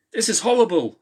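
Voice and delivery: high, loud horrified scream. A narrator's reading. Kate screaming in horror at the gunfire, high and loud. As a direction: cry out in horror. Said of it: "screamed Kate".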